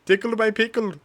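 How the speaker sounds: put-on high, loud Indian accent like a scam caller. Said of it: "that was Indian".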